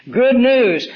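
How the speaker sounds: preacher bursting out with the good news; exclaimed good news. Real, not acted. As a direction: ring out Good news like a herald. Preacher proclaims the gospel point.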